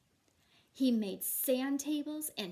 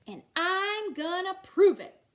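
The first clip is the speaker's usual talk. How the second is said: defiant; 6 semitones higher, 12 dB louder than usual. Acted. Matthew defiant.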